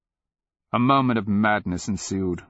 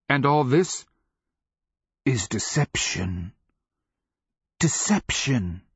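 slow, emphatic raspy pronouncement; grim, deliberate slave's voice; rasping slave; 4 semitones higher than usual. A narrator's reading. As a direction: draw out each word in a rasp. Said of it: "the fury of his barked reply".

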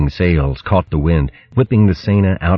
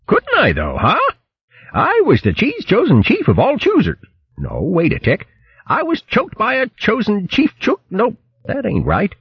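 much higher, tongue-twisting boast; boastful posh hare. Acted. Bo boasting to the crew of his cooking, high and pompous. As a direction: crow about it like a pompous hare.

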